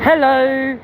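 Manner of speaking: very high friendly hello to strangers; sing-song hello. Real, not acted. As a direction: call out to passers-by brightly. Greeting strangers in passing.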